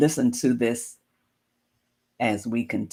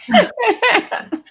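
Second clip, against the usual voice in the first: high burst of open laughter; real laugh; 8 dB louder than usual. Real, not acted. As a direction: let a loud laugh break out, pitched up. Laughs at friend's clip request.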